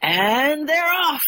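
high, drawn-out race-start call; acted commentator's kickoff cry; sports announcer. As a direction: announce the start to the whole stadium. Acts the match commentator's start.

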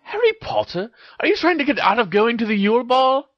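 high voice, questioning sharply; very high-pitched challenge to a friend; Hermione challenging Harry. A narrator's reading. Hermione confronts Harry in story.

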